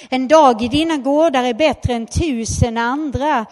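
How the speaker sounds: loud declaiming psalm reading; loud scripture proclamation. Acted. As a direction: read the psalm out to the congregation. Reads a psalm aloud.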